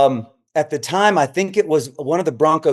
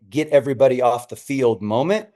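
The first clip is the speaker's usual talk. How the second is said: ordinary talk, nothing marked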